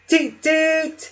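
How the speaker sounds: very high cheerful toot toot; merry toot. A narrator's reading. Friendly barge announcing herself with a merry high toot. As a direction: sing it out like a jolly barge horn. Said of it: "a merry".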